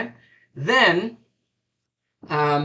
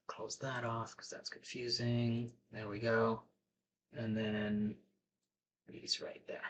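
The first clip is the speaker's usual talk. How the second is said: very soft, low murmur to yourself; low, half-whispered aside; quiet self-talk; 8 semitones lower, 17 dB quieter than usual. Real, not acted. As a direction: talk under your breath while fiddling with something. Muttering to self while adjusting screen.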